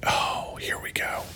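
soft, unvoiced whisper. Real, not acted. To viewers, bracing for the next post, whispered. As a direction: hush it like a secret.

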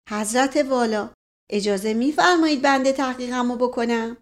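ordinary delivery, nothing marked

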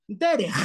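DERECH ending in a long scraped kh; strong kaf. Shown as a demonstration. Teacher demonstrating kaf, long throaty scrape. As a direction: rasp out the end of "Derech". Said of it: "no tanto como la kaf".

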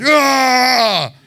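long, loud yell; drawn-out "aah" bellow; demonstrated roar. Shown as a demonstration. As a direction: let out a big open roar.

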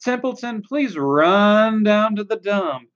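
singsong list of nagging requests; mocking demands. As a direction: punch each order with scorn. Rat mocks others' requests.

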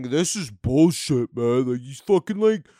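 lightly voiced dumb online bro, drawling; mocking angry commenter. Comic mocking an angry online commenter in a light dumb-bro voice. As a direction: sneer it like a riled-up anti-woke commenter. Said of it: "Are you one of these people going".

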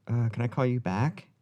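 pretend brush-off. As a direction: politely dodge the caller in a mock call. Mimed phone exchange, other party replies.

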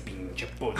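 whispered insult, barely voiced; inner whisper. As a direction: hiss it as a private thought. What he thought inside, silently. (pensamiento susurrado, casi sin voz)

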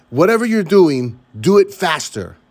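mock scream. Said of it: "motivation screams".

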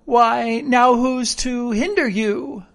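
sly castaway challenge; startled slyness. A narrator's reading. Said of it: "perked up into a kind of startled slyness".

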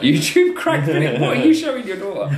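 high, disbelieving question; mock outrage at the parent; shocked. Real, not acted.

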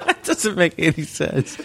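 high laugh, then slow words; cracking up. Real, not acted. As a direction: laugh hard then protest. Cracking up at absurd joke.

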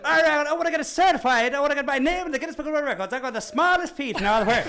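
high, loud, insistent mock demand; grumpy drunk dwarf. Second dwarf demanding certification, hammed-up insistent shout. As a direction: push the boast out like a cocky dwarf. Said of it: "I'm pretty sure he was grumpy".

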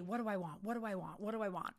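soft, low, repeated question to yourself; quiet self-questioning. Shown as a demonstration. Writing goals in her notebook, softly asking herself. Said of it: "writing down your goals".

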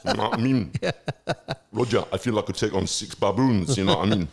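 loud, far-off boxer voice; Frank Bruno impression. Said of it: "Frank Bruno in the building".

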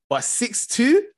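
high, slow, stunned; stunned disbelief. Real, not acted. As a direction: drag it out in disbelief. Disbelief at the final score.

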